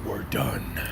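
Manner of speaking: very low, slow, growled threat; deep slow mock-villain voice; menacing boss. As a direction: drop deep and drag it out like a villain. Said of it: "Primalism says".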